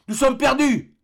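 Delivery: high, loud cry of alarm as the balloon tears; panicked cry. Acted. Fergusson crying out to his companions, high and alarmed. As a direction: shout that all is lost. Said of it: "s'écria Fergusson".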